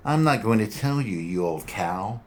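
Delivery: high sneering punk voice; defiant young punk. Acted. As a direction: sneer at the old woman. Young thug defies detective.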